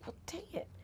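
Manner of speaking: a breathy whisper of mock frustration; whispered regret. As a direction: sigh it out under your breath. Imagined regret at the end.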